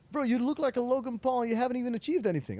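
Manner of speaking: a retold editor sneering at a flashy outfit; mock scornful. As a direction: throw the insult quickly with contempt. Editor mocks his outfit.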